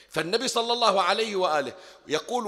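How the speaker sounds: ordinary delivery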